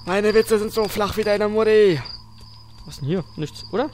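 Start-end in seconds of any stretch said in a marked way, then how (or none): 0.0-2.0 slow, teasing punchline voice; joking tone. Pretend. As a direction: deliver it as a cheeky joke. Joking about his own puns.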